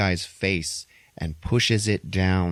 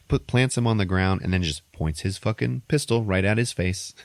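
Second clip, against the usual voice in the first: usual speaking voice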